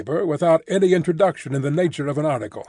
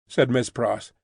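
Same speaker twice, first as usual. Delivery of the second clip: ordinary delivery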